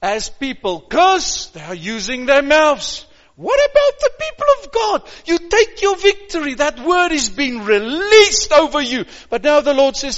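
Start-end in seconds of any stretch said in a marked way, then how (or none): 3.3-9.0 high, fast and fired up; rousing preaching. Acted. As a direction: lift the crowd with a soaring cry.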